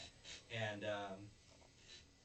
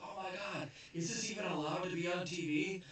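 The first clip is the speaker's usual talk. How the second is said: high, amazed viewers' gasp; shocked gasp; 8 semitones higher than usual. Retold or imitated. Viewers shocked by the TV show. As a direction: gasp at the screen in disbelief. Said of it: "and they're like".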